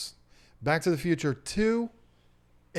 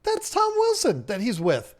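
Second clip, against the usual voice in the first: rising higher and louder into sudden recognition; excited recognition; 8 semitones higher, 4 dB louder than usual. Retold or imitated. Host retelling spotting Tom Wilson on a vlog, excited. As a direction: build to a thrilled double take. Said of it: "and I'm like".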